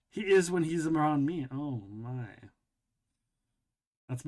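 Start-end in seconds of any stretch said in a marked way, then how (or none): 1.5-2.3 low, soft lightly voiced "oh my"; a hint of a prim gasp; Bentley impersonation. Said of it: "that's my Bentley impersonation".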